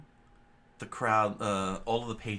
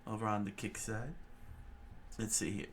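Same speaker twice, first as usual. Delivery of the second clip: ordinary delivery, nothing marked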